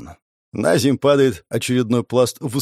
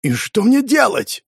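inner yell; 9 semitones higher, slower than usual. Acted. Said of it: "орал я в мыслях".

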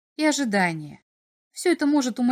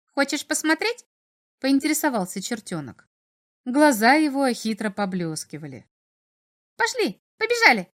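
high, sly little imp; 4 semitones higher than usual. A narrator's reading. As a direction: tempt him like a cheeky imp. Imp invites slyly.

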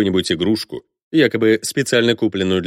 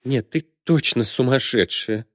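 slow, higher-pitched dismay; a touch of fright; alarmed; 5 semitones higher than usual. A narrator's reading. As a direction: say it slowly, taken aback. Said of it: "а потом и с испугом".

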